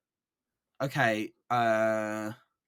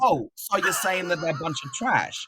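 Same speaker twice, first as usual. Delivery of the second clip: loud, indignant mock outrage; mock offended; 5 semitones higher, 7 dB louder than usual. Friend fakes outrage at a teasing accusation. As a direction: pretend to be insulted, playfully.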